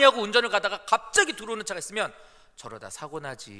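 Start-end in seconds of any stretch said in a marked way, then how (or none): 2.6-3.5 lower, softer passing comment; acted calm driver shrugging it off; mild, unbothered remark. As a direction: let it go with a quiet tut. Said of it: "이러고 건너가요".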